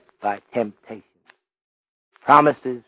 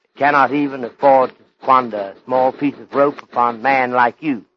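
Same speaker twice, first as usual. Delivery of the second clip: ordinary delivery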